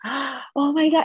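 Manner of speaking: high, slow, stunned "oh my God"; imitating the husband hearing the pregnancy news; overwhelmed gasp. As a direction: draw it out like joyful shock. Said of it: "He started crying on the phone".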